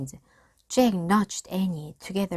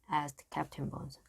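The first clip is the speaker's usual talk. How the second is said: usual speaking voice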